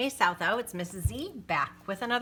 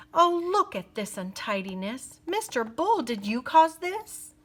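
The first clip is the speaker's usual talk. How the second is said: high, prim tut-tutting lady; fussy old lady; 7 semitones higher, 4 dB louder than usual. Acted. Fussy ladies scold the bull.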